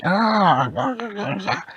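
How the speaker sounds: high yell breaking into short yelps; mock pained cry. Donkey Kong hit in the knees, mock pained cry. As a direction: cry out like a cartoon villain struck.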